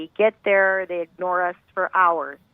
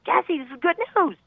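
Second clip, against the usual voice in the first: much higher, bright hopeful news; hopeful cheer; 11 semitones higher than usual. Retold or imitated. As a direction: cheer her up with good news. Mother cheering sick daughter.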